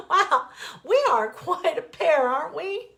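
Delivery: high, loud words through laughter; laughing gasp. Acted. Wife laughing at their shared fears, laughing gasp. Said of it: "she finally gasped out".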